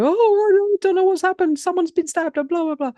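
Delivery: high-pitched imitation of tearful panic; mock panicked crying. Podcaster imitating a panicked, crying emergency caller, mock wail. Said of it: "he's crying".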